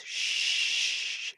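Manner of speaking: a long whispered shh; shushing sea. Retold or imitated. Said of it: "It goes".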